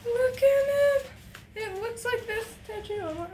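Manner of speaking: high thrilled look at it. Real, not acted. Vlogger showing a jellyfish tapestry to viewers, very high. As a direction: show it off in pure joy.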